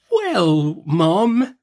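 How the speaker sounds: high, drawn-out timid reply; meek, halting doctor voice. A narrator's reading. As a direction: answer hesitantly as a meek doctor. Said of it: "in his meekest manner".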